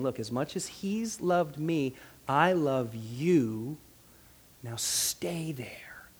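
very slow and soft, sinking to a whisper; tender declaration. Acted. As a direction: draw each word out tenderly, ending whispered. Voices Jesus tenderly declaring love.

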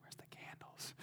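breathy unvoiced whisper, puzzled. Reader searching the Bible text, whispering. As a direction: mutter it under your breath like a confused reader. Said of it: "be like".

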